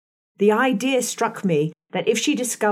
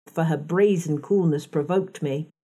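usual speaking voice

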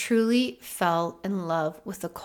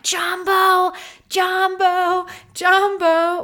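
high, loud hailing shout, retold; excited calling out; 10 semitones higher, 8 dB louder, much slower than usual. Retelling herself hailing Dan in a passing tuk-tuk, excited calling out. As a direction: call "jambo" after a passing ride, drawn out and high.

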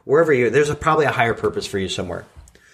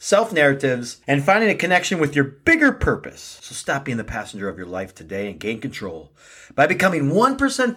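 upbeat pitch-man read, higher and punchy; promo ad read; 4 semitones higher than usual. Acted. Host pitching his coaching program to listeners, upbeat ad read. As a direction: sell the program like a radio spot.

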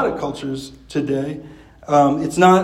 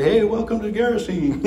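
high, offbeat voice of the possessed man; mock cheerful greeting; 6 semitones higher than usual. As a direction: play the wild man, oddly friendly. Wild man greeting Jesus.

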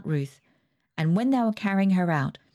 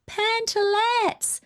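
much higher, stretched slow shriek; shrieked in chorus; much slower than usual. Acted. Sisters shrieking the word in chorus, high. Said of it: "shrieked Emma, Anne, and I in chorus".

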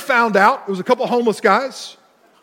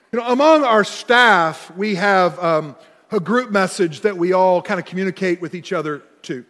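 normal talking voice

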